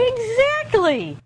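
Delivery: high, slow "exactly"; delighted teacher praise; high excited praise. Shown as a demonstration. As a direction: reward the answer with delight. Praising child's answer.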